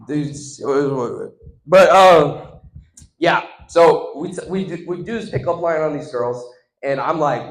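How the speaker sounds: ordinary talk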